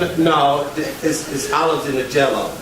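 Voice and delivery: ordinary talk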